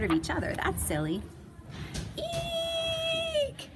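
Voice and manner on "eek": very high frightened eek; scared shriek. Acted. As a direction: shriek in terror. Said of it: "yelled Sam".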